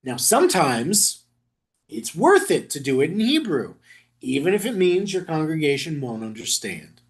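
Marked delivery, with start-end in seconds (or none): none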